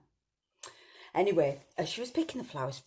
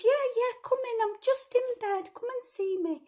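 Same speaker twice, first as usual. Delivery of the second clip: much higher, louder quavery granny; grandma voice; 4 dB louder than usual. Acted. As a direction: call out like a frail old granny. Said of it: "And Grandma said".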